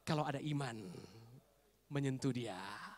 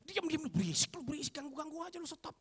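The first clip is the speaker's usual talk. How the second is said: mock-fierce, higher-pitched shushing; fierce bodyguards; 10 semitones higher than usual. Overzealous bodyguards silencing a shouting blind man, mock-fierce. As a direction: hush the noisy man with quick scolding. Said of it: "Lebih galak daripada pemimpinnya".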